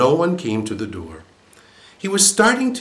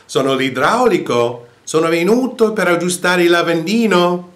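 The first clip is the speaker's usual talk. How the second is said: louder, higher call through the door; 8 semitones higher, 7 dB louder than usual. A narrator's reading. Plumber thinking the woman is deaf, louder call. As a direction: raise your voice for a deaf listener. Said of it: "questa volta ad alta voce".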